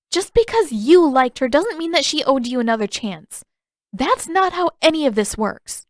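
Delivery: put-on angry young Katara. A narrator's reading. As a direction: push back hard, offended for her grandmother.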